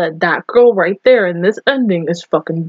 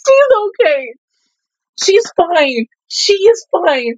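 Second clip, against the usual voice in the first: high-pitched, upset insistence; high voice, refusing to accept a loss; distressed denial; 7 semitones higher, slower than usual. Real, not acted. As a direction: insist through distress that all is well. Upset denial of character's death.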